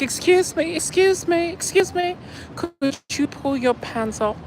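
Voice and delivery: high, prissy mock voice of a fussy staff member; mocking staff woman.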